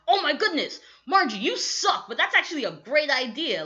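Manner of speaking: put-on a teen gasping at a great idea; excited Xenon. Xenon thrilled by Margie's remark.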